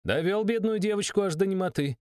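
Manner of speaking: high indignant shout; a young woman shouting at the count to distract him. A narrator's reading. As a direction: yell to pull his attention onto her. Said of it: "закричала она".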